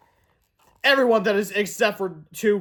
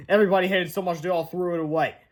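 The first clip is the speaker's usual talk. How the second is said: plain reading voice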